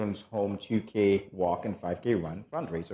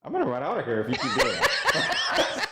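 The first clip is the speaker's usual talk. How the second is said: laughing; 5 semitones higher than usual. Real, not acted. Laughing at cohost.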